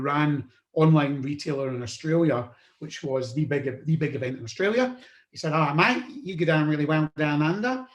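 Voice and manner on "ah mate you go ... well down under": light, hammed-up Australian twang; a bad Aussie impression of a friendly promoter; loosely done Australian accent; terrible Australian accent. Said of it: "in my terrible Australian accent".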